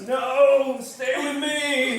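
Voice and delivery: high, drawn-out plea; pleading demo. Shown as a demonstration. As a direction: show the desperate plea slowly. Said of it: "come back down and just".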